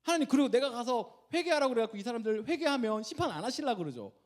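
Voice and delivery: high, loud, whining complaint to God; disgruntled protest. Acted. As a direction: act out an indignant "why me?" objection. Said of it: "요나가 딱 그 표정이에요".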